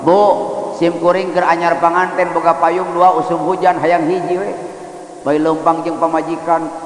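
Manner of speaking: ordinary talk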